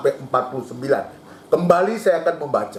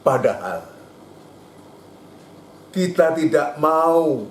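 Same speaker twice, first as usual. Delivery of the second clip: ordinary delivery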